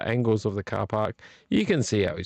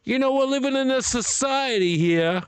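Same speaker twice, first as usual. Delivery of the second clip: high-pitched, put-on sitcom outburst; Seinfeld outburst; 11 semitones higher than usual. Quoting Seinfeld at a bad parker, exasperated high voice. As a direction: cry out in exasperation, much higher than usual. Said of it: "in the, in Seinfeld".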